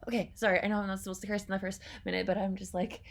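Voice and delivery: normal talking voice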